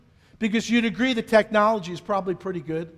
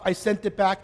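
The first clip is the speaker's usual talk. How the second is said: normal talking voice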